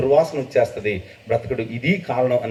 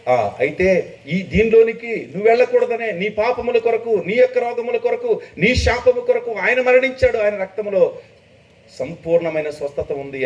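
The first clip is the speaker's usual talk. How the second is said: high, ringing revival preacher voice, much higher; preacher's call from the stage, imitated; imitating a preacher; 11 semitones higher than usual. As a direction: mimic the fiery Brahmin preacher in full flow. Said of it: "ఆ సభల్లో ఆయన నరకాన్ని గురించి మాట్లాడుతూ".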